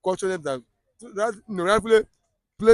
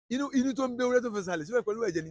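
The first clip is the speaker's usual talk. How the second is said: fast Yoruba proverb; 3 semitones higher, 8 dB quieter, much faster than usual. Shown as a demonstration. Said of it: "there's a proverb in my language".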